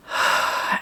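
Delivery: long audible sigh out; centering out-breath; demonstrated exhale. Shown as a demonstration. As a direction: let the breath go in a soft sigh. Models breathing during meditation.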